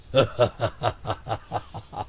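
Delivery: deep, soft demonic chuckle; evil devil cackle. Acted. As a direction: gloat like a devil. Devil laughs. (शैतानी हंसी)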